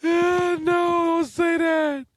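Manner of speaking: high, loud, drawn-out protest; pleading shout. Real, not acted. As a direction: beg him to stop, shouting. Begs him not to say it.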